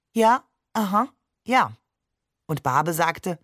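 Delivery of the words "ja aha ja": higher, louder, dutiful agreement; polite humoring. Acted. Wife politely humoring her husband, diplomatic agreement. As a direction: humor him without real interest. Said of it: "ihr Diplomatenlächeln".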